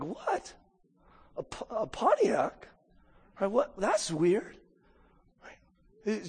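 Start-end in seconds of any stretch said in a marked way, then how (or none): 0.0-4.6 baffled. Retold or imitated. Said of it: "you would be thinking, like".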